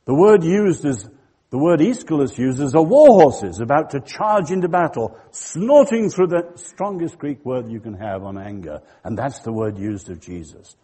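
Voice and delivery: ordinary delivery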